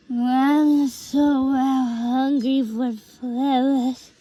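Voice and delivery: slow, indistinct drawn-out mumble; mumbling. A narrator's reading. Twilight mumbling to herself, only partly heard, slow mumble. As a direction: slur the words into a barely understood mumble. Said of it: "She kept mumbling something".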